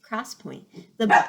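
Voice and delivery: usual speaking voice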